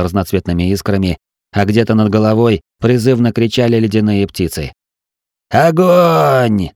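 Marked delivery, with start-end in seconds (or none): 5.5-6.7 high, drawn-out battle cry; shouted command. A narrator's reading. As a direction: shout the order to fire across the deck. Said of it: "закричал я".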